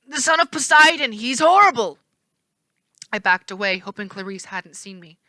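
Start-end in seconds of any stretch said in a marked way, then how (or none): 0.1-2.0 higher, louder terrified cry. A narrator's reading. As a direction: recoil in terror. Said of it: "terrified sound".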